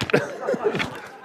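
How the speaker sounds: high breathless wheeze of laughter; preacher cracking up at his own story, wheezing laugh. Real, not acted. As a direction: laugh with no voice behind it.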